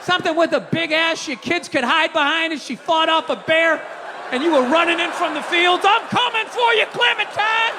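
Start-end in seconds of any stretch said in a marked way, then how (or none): 5.9-7.8 calling out. Retold or imitated. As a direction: call out to her as you run. Pioneer calling to wife.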